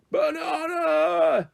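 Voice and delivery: high, long-drawn roar; hungry gorilla calling out; giant gorilla roar. A narrator's reading. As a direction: stretch each vowel into a slow animal bellow. Gorilla roars again.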